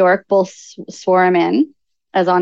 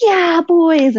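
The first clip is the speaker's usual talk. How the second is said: high, drawn-out cheer; excited little boy; 9 semitones higher, 3 dB louder than usual. Retold or imitated. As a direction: imitate a child's proud whoop. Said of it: "Trucker was always like".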